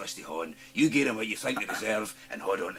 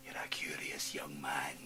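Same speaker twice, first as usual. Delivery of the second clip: slow menacing whisper; 5 semitones lower, 5 dB quieter than usual. Acted.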